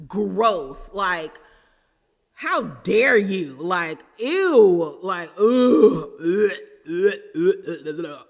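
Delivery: loud, drawn-out disgusted groans; grossed-out loud ughs. Real, not acted. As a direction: spit out ugh in revulsion. Disgusted at the husband.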